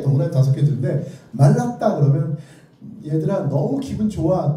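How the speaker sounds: usual speaking voice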